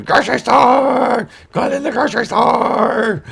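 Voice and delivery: very high, loud, slow singsong shout; gleeful high yell. Retold or imitated. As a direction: skip in yelling like an overjoyed child.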